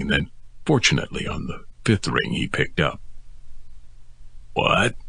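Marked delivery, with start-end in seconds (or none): 4.5-4.9 croaked. A narrator's reading. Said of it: "It was croaked into my ear".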